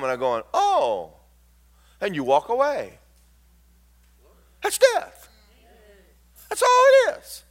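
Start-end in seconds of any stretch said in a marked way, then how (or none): 0.5-1.1 high, startled little oh; a sudden realising oh; high-pitched startled oh; surprised oh. Retold or imitated. Told he is going home. 4.6-7.4 much higher, building preacher cry; preaching high. Acted. Preaching climax on death.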